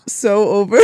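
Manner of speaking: laughing through the words; giggling as you say it. Real, not acted. Laughing at long session.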